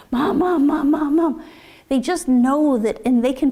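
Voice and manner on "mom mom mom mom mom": mock toddler pestering, a bit higher and insistent; nagging toddler. Clingy two-year-old calling an upset parent, mildly whiny nagging. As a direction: imitate a small child calling Mom over and over.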